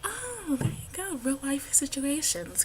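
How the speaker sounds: high, drawn-out "oh" of approval; mock realisation. Student replaying her reaction to teacher's example, mock realisation. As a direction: pitch it up as if it just clicked.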